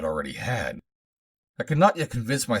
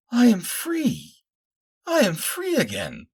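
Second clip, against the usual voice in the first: slow, very high cries of joy; high, drawn-out exultant outburst; hysterical cry; 6 dB louder, slower than usual. A narrator's reading. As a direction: draw out each cry, hysterical with relief. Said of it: "she cried hysterically".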